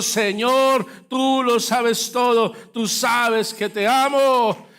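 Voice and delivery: Peter's answer, slow fervent preaching; fervent preached confession. Acted. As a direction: lean on every word as a cry of love. Climax of the sermon story.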